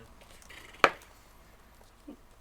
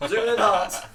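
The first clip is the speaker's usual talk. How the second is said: louder, higher, hammed-up shout; loud excited shout; 10 semitones higher, 15 dB louder than usual. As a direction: yell it like a TV detective. Movie cliché call.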